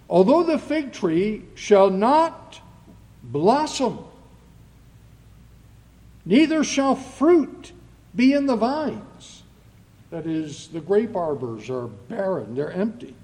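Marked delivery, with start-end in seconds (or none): 0.1-9.4 loud, raised, declaimed Bible verse; ringing pulpit reading, phrase by phrase; scripture reading. Acted. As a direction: proclaim the verse to the congregation. Reads scripture aloud in sermon.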